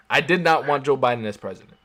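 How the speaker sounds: arguing hard, raising his voice; heated loud insistence. Real, not acted. To his co-host, loudly refusing both candidates, heated. Heated argument about presidents.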